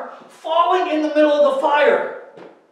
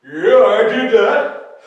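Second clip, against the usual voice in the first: king's slow reply; 3 semitones lower, 8 dB louder, much slower than usual. Acted. King answers the tattlers.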